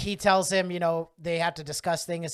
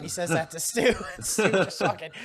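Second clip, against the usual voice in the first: usual speaking voice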